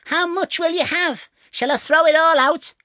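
very high, piercing yell; bawling tiny man. A narrator's reading. Tom ignores the thieves' hushing, bawling high. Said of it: "bawled out again".

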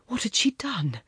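ordinary delivery

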